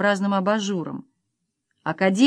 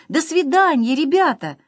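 wanted shout; 7 semitones higher, 3 dB louder than usual. A narrator's reading. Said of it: "Я хотел крикнуть им".

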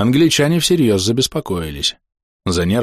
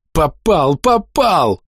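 rising, high triumphant cries; joyful shout; 8 semitones higher, much slower than usual. A narrator's reading. Gunner celebrating his hit, joyful shout. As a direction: cry out the hit with joy. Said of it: "закричал радостно Пантелей".

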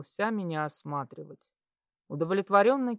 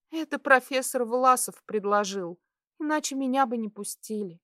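put-on higher girl's voice, a touch bashful; modest explanation; 8 semitones higher, 5 dB louder than usual. A narrator's reading. Girl embarrassed before the patient, modest and higher. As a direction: explain yourself modestly in a light voice. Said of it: "скромно сказала она".

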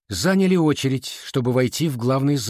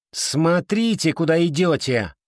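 higher, mildly cross scolding; irritated snap; 6 semitones higher than usual. A narrator's reading. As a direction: snap lightly at someone in the way. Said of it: "рявкнула она". (слегка раздражённый окрик)

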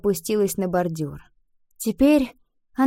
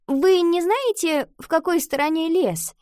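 put-on high-pitched girl's question; girl asking the way; 9 semitones higher than usual. A narrator's reading. Ива asks classmates where the forest is, high voice.

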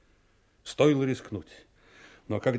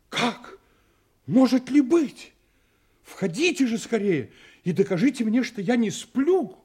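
higher, rising disbelief as if he can't believe his eyes; astonished cry; 8 semitones higher than usual. Retold or imitated. Butler imitating Hess greeting the girl, amazed exclamation. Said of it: "он закричал".

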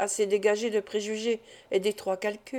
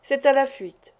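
a raised, pointed exclamation; exclaiming; 4 semitones higher, 5 dB louder than usual. A narrator's reading. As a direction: throw out the correction with a touch more force. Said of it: "s'écria Phyllis".

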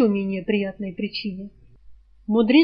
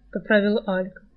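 usual speaking voice

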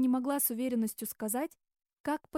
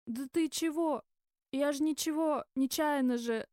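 muttering; 3 semitones higher than usual. A narrator's reading. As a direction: mumble a defensive excuse as the drunk. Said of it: "забормотал он". (пьяное оправдывающееся бормотание)

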